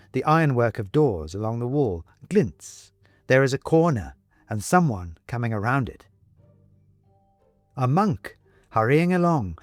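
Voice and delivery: ordinary delivery, nothing marked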